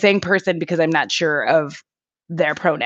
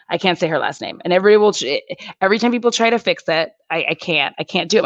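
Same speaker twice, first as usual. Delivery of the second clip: usual speaking voice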